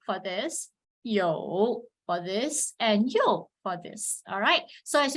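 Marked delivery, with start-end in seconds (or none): 1.0-1.8 "yo" slow, low and dipping. Shown as a demonstration. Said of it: "Say, for example". 3.1-3.5 "yo" falling. Shown as a demonstration. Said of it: "Say, for example".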